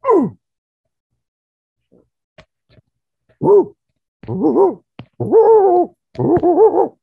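loud, high ape hoots; ape hooting. Retold or imitated. Ape man with no language, loud hooting. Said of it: "can't talk".

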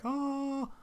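awed gasp. Retold or imitated. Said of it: "I think I was more like".

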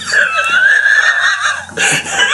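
high squealing laughter; real high laughing fit. Real, not acted. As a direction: burst out laughing. Laughs at own pillow scenario.

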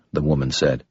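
usual speaking voice

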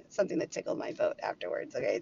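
soft giggling whisper-laugh; laughing softly. Real, not acted. Teasing viewers about the next story, laughing. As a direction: let the giggle leak through.

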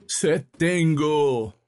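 high, very slow, sorrowful intoning; mournful preaching. A narrator's reading. Priest preaching the Calvary to his flock, mournfully intoned. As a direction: draw each word out in lofty grief. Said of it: "continuaba el sacerdote".

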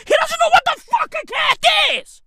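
fast, high-pitched comic outburst; exasperated shout. Acted. Climax of incredulous cat rant.

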